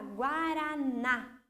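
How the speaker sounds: guaraná drawn out slowly towards a stressed final ná; stress on last syllable. Shown as a demonstration. Teacher showing pupils the strongest syllable, guaraná slowly with stressed ná. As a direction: stretch guaraná and land on the last ná. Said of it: "é a sílaba mais forte".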